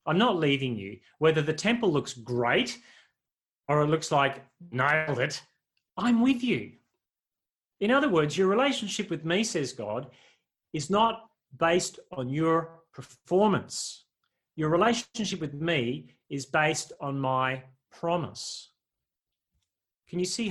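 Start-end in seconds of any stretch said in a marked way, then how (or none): 0.1-6.8 passionate declaration. Acted. Preacher voicing God to his people, fervent loud promise. 8.4-18.7 louder, slowing on performance; emphatic proclamation. Acted. Voicing God, emphatic contrast.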